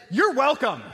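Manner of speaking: pointedly sarcastic retort, retold. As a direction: throw it back with exaggerated false grace. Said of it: "I don't think that sarcasm is a hallmark".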